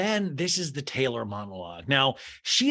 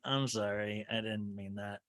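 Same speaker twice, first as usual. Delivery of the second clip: much softer and lower, a fake meek sorry; mock sheepish apology; 7 semitones lower, 11 dB quieter than usual. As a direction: back down with a pretend small voice. Mock apology after being cocky.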